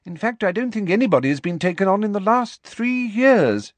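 high-pitched, emphatic careers talk; stern professor. Acted. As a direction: advise a pupil with firm authority. McGonagall advising Potter.

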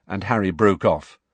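ordinary delivery, nothing marked

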